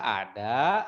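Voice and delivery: usual speaking voice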